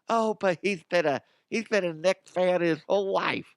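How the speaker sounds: high, mocking fan voice; mock defensive fan; whiny fan. Mimicking a defensive Knicks fan.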